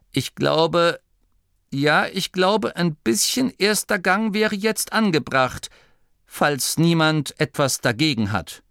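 high, halting, timid suggestion; shy. A narrator's reading. Duke's son cautiously suggests first gear, shyly. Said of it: "sagte der Sohn des Herzogs schüchtern".

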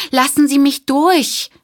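high, loud, slow plea to pass; a flustered operator calling to get through the crowd. Acted. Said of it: "rief die Telefonistin".

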